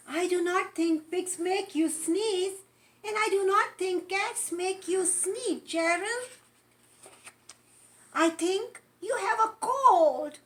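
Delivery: doctor cat voice. Acted.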